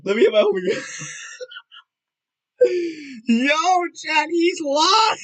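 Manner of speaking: high laughing shout; laughing excitement. Real, not acted. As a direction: blurt it out through laughter. Laughing announcing to chat.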